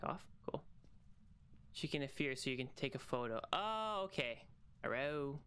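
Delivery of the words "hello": normal talking voice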